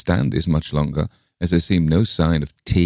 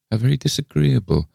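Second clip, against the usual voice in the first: high-pitched polite disapproval; polite lady visitor; 8 semitones higher than usual. A narrator's reading. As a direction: sympathise primly in a raised pitch. Miss Pym answers.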